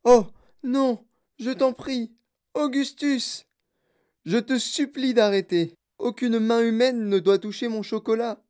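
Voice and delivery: high, anxious exclaimed plea; pleading cry. A narrator's reading. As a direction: beg urgently, crying out in protest. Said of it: "Monsieur Wonka s'écria".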